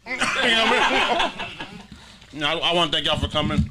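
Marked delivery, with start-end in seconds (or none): 0.1-2.4 laughing while asking; words breaking into laughter. Real, not acted. Laughs through the question.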